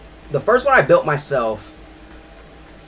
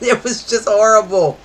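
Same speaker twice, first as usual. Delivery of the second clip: real laughs round the words, higher pitch; laughing; 5 semitones higher, 3 dB louder than usual. Real, not acted. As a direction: say it through laughter. Laughing over a bad old computer.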